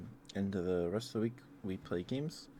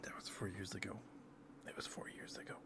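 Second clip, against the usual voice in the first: soft unvoiced whisper; 13 dB quieter than usual. Real, not acted. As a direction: breathe it out quietly. ASMR whisper aside.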